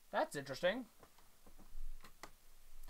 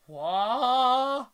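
loud high shocked What; shocked shout; 7 semitones higher, 13 dB louder than usual. Acted. Girl reacting to the new boy, shocked loud. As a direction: blurt it out in shock.

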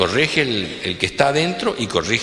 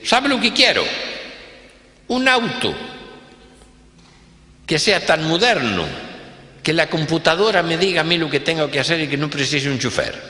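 demanding rich boss; 8 semitones higher than usual. Acted. Rich man orders his manager.

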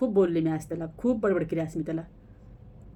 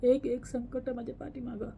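much softer, near-tearful sigh to God; despairing lament; 9 dB quieter than usual. Real, not acted. Worried mother laments to God about her son, hushed and despairing. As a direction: pray in quiet despair.

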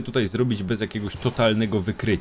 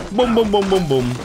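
playful sound effects; 7 semitones higher, 4 dB louder than usual. Real, not acted. Gamer hitting enemies repeatedly.

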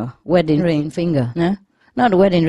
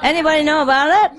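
high, fast call to the room; a host calling out to the party crowd; 11 semitones higher, 4 dB louder, much faster than usual. Real, not acted. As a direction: fire the question out to everyone.

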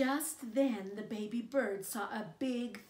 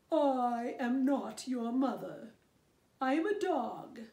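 louder gruff dog voice; 3 semitones higher, 7 dB louder than usual. Acted. Dog telling the baby bird it's not his mother, louder dog voice. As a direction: answer like a big dog. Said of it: "said the dog".

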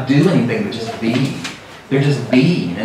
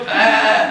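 a high, mock human "bah"; comic copycat bleat; man bleating; 9 semitones higher than usual. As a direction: bleat back at the sheep. Man bleating back at sheep.

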